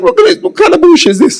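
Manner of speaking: can't believe the offer, squeal it out; incredulous outburst. Retold or imitated. Outraged at the travel-expense pay.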